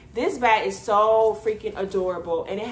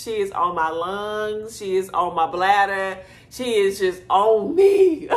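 normal talking voice

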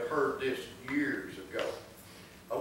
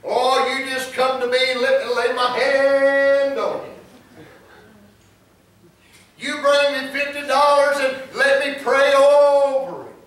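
mock TV preacher; 10 semitones higher, 12 dB louder than usual. Imitated TV preacher solicits money for prayer, hammed-up and loud. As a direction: stretch the key words like a showy TV healer. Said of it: "Now you watch T V preachers".

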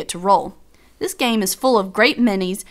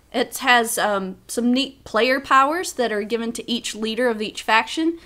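ordinary talk, nothing marked

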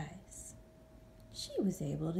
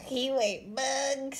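stuttered; 6 semitones higher, much slower than usual. Acted. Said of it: "stuttered one".

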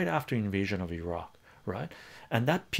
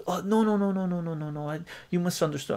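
high, louder flustered no no no; mock panicked denial; 9 semitones higher, 3 dB louder, faster than usual. The man denies wanting the invasion, flustered high denial. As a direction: backpedal in a hurry, voice up. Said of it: "He goes".

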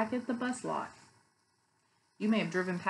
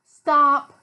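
loud, higher "stop"; storybook call-out; 5 semitones higher, 15 dB louder than usual. A narrator's reading. Teacher reads the bus book to children, loud call-out. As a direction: call it out like a command in a picture book. Reading picture book aloud.

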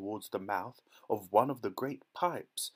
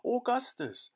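very high-pitched summons; high storybook mother's call; 13 semitones higher than usual. A narrator's reading. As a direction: call your wayward son sharply. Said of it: "called out Missus Gloop".

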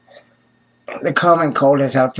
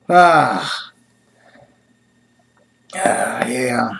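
ordinary delivery

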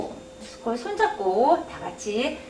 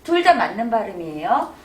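ordinary talk, nothing marked

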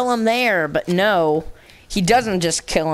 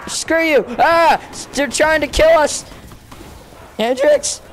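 higher, louder alarmed cry; panicked yell; 8 semitones higher, 3 dB louder than usual. Real, not acted. Gamer under fire yelling to teammates, a higher, louder panic. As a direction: shout for help as they close in. Attacked by enemies in game.

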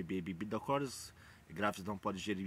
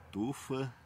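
ordinary talk, nothing marked

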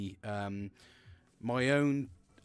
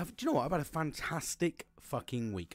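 ordinary talk, nothing marked

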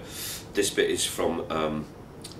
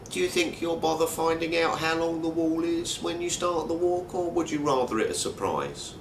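a lightly raised, dry Tony voice; Tony's voice; 9 semitones higher than usual. Retold or imitated. Tony asks Mark about the wall, deadpan and pitched up. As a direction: play Tony's deadpan question, pitched higher.

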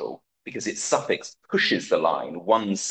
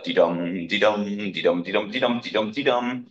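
dee-DUM chanted in a strict, even beat; mock iambic beat. Shown as a demonstration. Lecturer mocking regular pentameter as the Pink Panther tune. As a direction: tap out a monotonous iambic rhythm. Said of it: "like the theme tune to the Pink Panther".

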